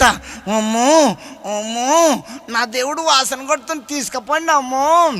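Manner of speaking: crying mock sob, then complaining. Widow after three days complains the body stinks, mock crying. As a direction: whimper it through fake tears. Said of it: "కళ్ళనీళ్ళు తుడుచుకొని ఈమె అంటుందంట".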